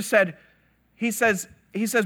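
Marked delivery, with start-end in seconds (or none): none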